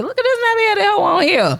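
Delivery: high mock outrage; hammed-up disgusted commentator; mock outraged voice. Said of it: "I Don Imus that shit".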